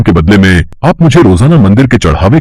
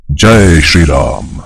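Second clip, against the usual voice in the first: devout reverence; 5 semitones lower, much slower than usual. Acted. Hanuman bows to Lord Ram.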